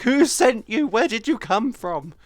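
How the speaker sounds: play-acting panic at a new follower; mock alarmed. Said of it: "I'm like".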